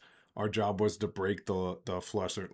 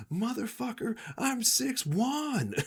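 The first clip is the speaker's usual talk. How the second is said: much higher, drawn-out outraged cry; indignant yell; 3 dB louder, slower than usual. Retold or imitated. Old boss on the ground shouting at the bounty hunter, outraged and high. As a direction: stretch it out, wounded pride exploding.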